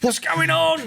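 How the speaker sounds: high squeaky alarmed voice; mock panicked man. Imagining OSB with dreadlocks, high panicked squeal. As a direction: squeal it like a shocked man at the mirror. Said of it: "he'll be like".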